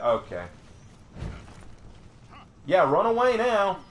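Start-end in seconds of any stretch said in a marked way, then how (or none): 2.7-3.8 high-pitched jeering at an opponent; goading, high and insistent; taunting. Real, not acted. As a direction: jeer after a fleeing enemy in a raised pitch.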